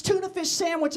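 ordinary delivery

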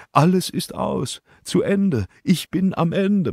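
mild despair, voice lifted and slowed. Acted. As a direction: let her give up entirely. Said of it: "Verzweiflung in ihrer Stimme".

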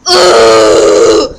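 loud deep grunt of disgust; annoyed grunt. Real, not acted. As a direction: grunt with irritation. Gruñido de fastidio.

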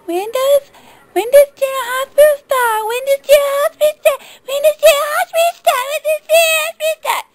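high, fast, squeaky repeated whine; mock the dim fans asking over and over; whiny high caricature of a confused viewer; mocking clueless viewer. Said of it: "People only hear half".

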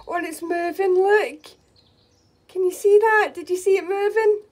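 high, loud and thrilled; bursting with real excitement over a moving chick; excited voice. Real, not acted. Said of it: "Sorry for my excited voice".

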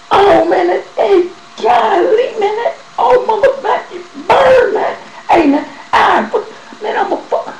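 very high, loud cries of burning pain; pain scream. Retold or imitated. After the doctor's shot burns, high screaming in pain. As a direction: howl as the injection burns.

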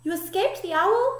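put-on high, astonished young bat; amazed cry. A narrator's reading. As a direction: cry out the question in wonder. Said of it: "cried Stella Luna".

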